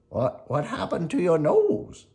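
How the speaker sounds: a father shocked at his son's nose; startled Geppetto. Acted. Geppetto seeing Pinocchio's nose at home, alarmed. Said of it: "he cried".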